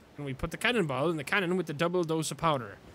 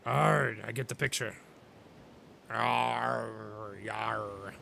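zombie pirate grunt; 6 semitones lower, 4 dB quieter, much slower than usual. Acted.

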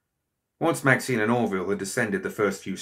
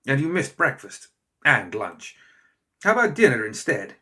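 eager; 5 semitones higher, 4 dB louder than usual. A narrator's reading. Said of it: "he added eagerly".

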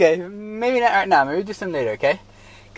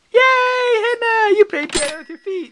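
excited cheer. Real, not acted. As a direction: celebrate her like a fan. Cheering toddler's piano play.

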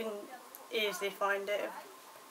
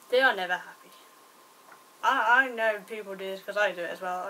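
ordinary talk

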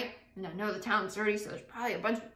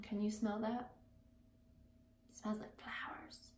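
soft whisper; 12 dB quieter than usual. Real, not acted. Storyteller whispering about the lavender smell, hushed. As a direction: breathe it out quietly.

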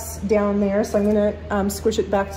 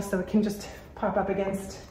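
out of breath; 2 semitones lower, 8 dB quieter than usual. Real, not acted. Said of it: "I'm really out of breath today".

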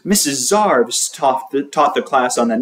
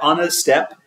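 ordinary delivery, nothing marked

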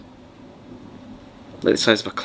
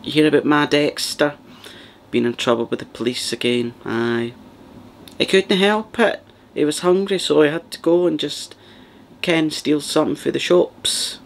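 put-on Glaswegian mum defending her boy, pitched up; Glaswegian dinner lady; 5 semitones higher than usual. Dinner lady making excuses for her son: higher Glaswegian whine. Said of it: "good kind of rendition ... of what they sound like".

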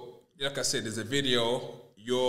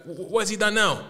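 higher, stuttering disbelief; retold "what has he done now", pitched up; stammering surprise; 8 semitones higher, 3 dB louder than usual. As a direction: stumble over it in mock shock. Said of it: "I'm like".

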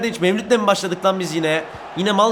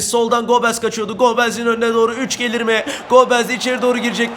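normal talking voice

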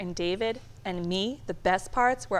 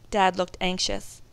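ordinary delivery, nothing marked

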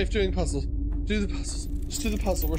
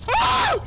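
very high, loud shriek; startled scream; 8 dB louder than usual. Real, not acted. Gamer jolted by a giant spider, shrieking high.

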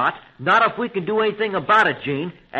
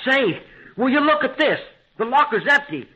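higher, with a short disbelieving laugh; surprised discovery; 6 semitones higher than usual. Acted. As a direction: react to finding the locker empty. Finds the gun locker empty.

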